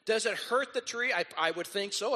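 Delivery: normal talking voice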